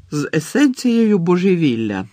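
loud, high interjection; blurted suggestion. A narrator's reading. Ron finishes Hermione's question about the bottle, blurting loudly.

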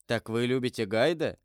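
ordinary delivery

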